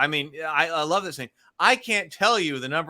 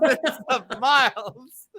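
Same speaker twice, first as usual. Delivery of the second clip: much higher, slow, laughing correction; hammed-up Scotty backpedalling; imitating Scotty; 8 semitones higher, slower than usual. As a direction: correct yourself sheepishly through a laugh. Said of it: "he goes".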